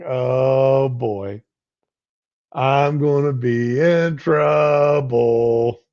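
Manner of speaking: slow, drawn-out mock worry; mock dread. Wife realizing she agreed too fast, slow mock dread. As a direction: stretch it out like you've been caught. Imagined wife's inner dread.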